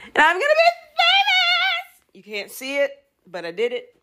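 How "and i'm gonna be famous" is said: very high, loud dramatic proclamation; shrieked over-the-top famous line; Manny from Degrassi. Acted. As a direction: belt it like a teen TV diva. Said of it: "Should I do that?".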